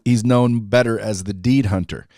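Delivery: ordinary delivery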